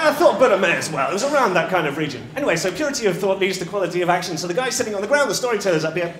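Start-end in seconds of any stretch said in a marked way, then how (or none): 2.4-6.1 loud, hurried recap; speaking too quickly. Real, not acted. Narrator hurries back to the tale, talking too quickly. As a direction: rush back into the story, louder and higher. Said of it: "And I speak too quickly".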